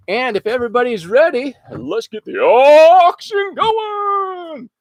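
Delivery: high, drawn-out announcer call; auctioneer voice. Shown as a demonstration. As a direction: belt it out like an auctioneer. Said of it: "How's that for my auctioneer voice?".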